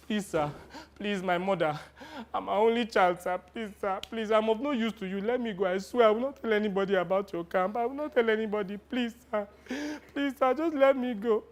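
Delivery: put-on boy soldier begging the commander to release him. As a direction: plead for your life. Boy begging for his life.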